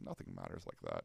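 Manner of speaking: calm resolve. Retold or imitated. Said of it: "I was like".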